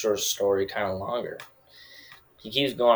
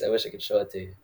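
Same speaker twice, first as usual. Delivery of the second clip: ordinary talk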